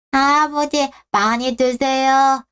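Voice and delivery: put-on high, slow little child's voice; a shy toddler greeting grandpa; small child. (할아버지에게 인사하는 어린아이 목소리)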